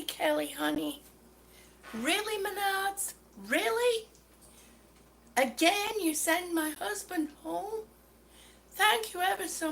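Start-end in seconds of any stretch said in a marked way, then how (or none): 1.9-7.8 incredulous, rising questions; exasperated disbelief. Real, not acted. Annoyed customer calling out Menards on a livestream, incredulous. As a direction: call out the store in annoyed disbelief.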